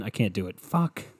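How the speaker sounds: ordinary talk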